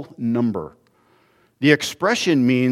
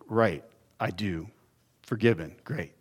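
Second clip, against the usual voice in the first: weary mock forgiveness; 4 semitones lower, 8 dB quieter than usual.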